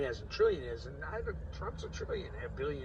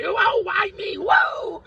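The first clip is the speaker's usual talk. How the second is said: high, loud cry of wonder; mock holy rapture, shouted high; ecstatic shout; 15 dB louder, much slower than usual. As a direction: yell it like the spirit just hit you. Mocking someone touched by God.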